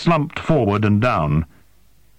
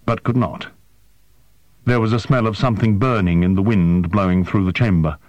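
plain reading voice

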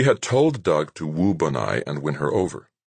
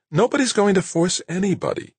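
firmly. A narrator's reading. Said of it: "quite firmly".

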